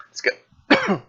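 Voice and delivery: strained, choked-off words; choking on water. Real, not acted. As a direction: voice catching on swallowed water. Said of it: "went down the wrong pipe".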